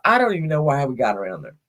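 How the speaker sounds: normal talking voice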